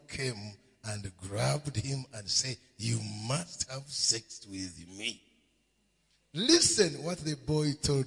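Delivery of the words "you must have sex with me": low seductive demand. Acted. Woman demands sex in story.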